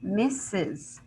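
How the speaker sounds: "misses" said slowly as MISS-iz; teacher carefully sounding out "misses" in two beats; extra final syllable. Shown as a demonstration. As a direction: make the "es" in "misses" its own syllable, "iz". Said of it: "we're going to say misses".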